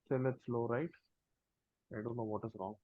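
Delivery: faint, muttered under the breath; fading out, too soft; barely audible. Real, not acted. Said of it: "but still low".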